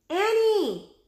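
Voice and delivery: high, loud urgent call; cry out. A narrator's reading. Jack crying out to Annie by the river. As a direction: cry her name in alarm. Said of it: "he cried".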